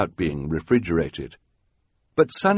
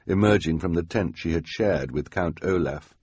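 usual speaking voice

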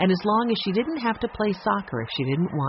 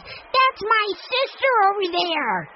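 top-of-voice shout; slower than usual. A narrator's reading. Benny shouts to the crowd that Jessie is his sister. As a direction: yell it proudly to the whole crowd. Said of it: "shouted at the top of his voice".

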